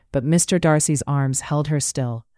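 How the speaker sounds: ordinary delivery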